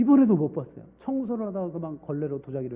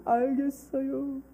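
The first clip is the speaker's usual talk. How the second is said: put-on resigned child; 8 semitones higher, much slower than usual. Child gives in.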